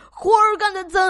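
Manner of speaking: loud, high farmer shout cut short; 农夫惊醒后大声嚷嚷; farmer yelling. Acted. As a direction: yell from bed then break off. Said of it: "又嚷嚷起来".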